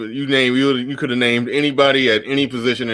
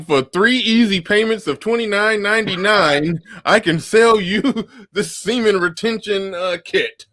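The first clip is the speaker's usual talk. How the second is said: mock infomercial pitch; 11 semitones higher than usual. Friend mocking a scheme as an infomercial, hammed-up and high. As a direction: sell it like a late-night infomercial host.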